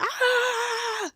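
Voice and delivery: sudden high mock yelp; a short high startled cry; imitated panicked yelp. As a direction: squeal like a dog freaking out. Dog melts down, freaking out.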